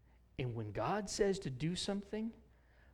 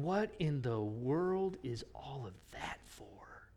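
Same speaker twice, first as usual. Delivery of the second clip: hushed, breathless whisper of disbelief; a whispered gasp at a fortune; awed whisper; 2 semitones lower, 6 dB quieter, slower than usual. Retold or imitated. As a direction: whisper it, stunned by the money. Mary and Joseph stunned by gold.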